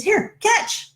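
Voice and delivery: high-pitched mock call to a buddy; imitated yell. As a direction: call it out high, as if tossing something over. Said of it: "he yells to his buddy".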